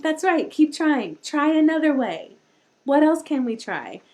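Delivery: encouraging aunt. Retold or imitated. Aunt encouraging nephew to keep trying, loud high cheerful praise. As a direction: cheer the little one on. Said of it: "I'm like".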